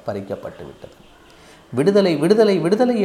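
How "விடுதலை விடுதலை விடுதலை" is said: loud, high plea for freedom; desperate cry. A narrator's reading. Caged parrot begging for release, crying out desperately. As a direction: cry out in anguish, pitched high. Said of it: "என்று நான் கதறும்படியாகிவிட்டது".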